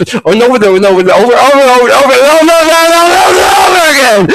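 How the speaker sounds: spiral into a frantic loop; building frantic gabble; rapid-fire repetition. Acted. Accelerating repetition rant.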